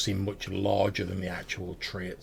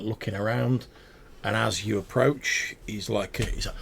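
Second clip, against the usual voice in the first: usual speaking voice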